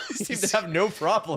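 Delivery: laughing. Real, not acted. Laughing mid-sentence at oil wars.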